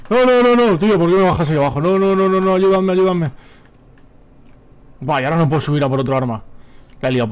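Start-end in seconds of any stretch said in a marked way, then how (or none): 0.1-3.3 high, loud desperate begging; panicked pleading. Real, not acted. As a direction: yell for help in full panic. Dragged down, begs for help. (súplica aterrada a gritos)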